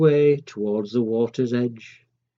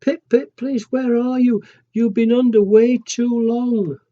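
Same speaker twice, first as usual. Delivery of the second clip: high, louder anxious calling; worried cry; 8 semitones higher, 5 dB louder than usual. Acted. Mummy cries out searching for Pip-Pip in the sea. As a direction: call out in rising panic for him. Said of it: "she cried out".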